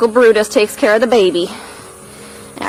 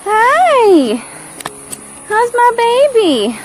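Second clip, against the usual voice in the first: much higher, louder gushing hello; excited greeting; 7 dB louder, much slower than usual. Real, not acted. Greets her cow Annabelle.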